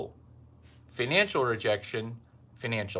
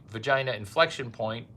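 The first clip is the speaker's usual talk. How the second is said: ordinary delivery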